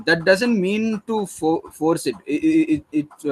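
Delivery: ordinary delivery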